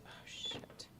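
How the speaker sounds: breathy whispered oh shit; whispered curse. Real, not acted. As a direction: whisper it under your breath. Stream glitch, muttered curse.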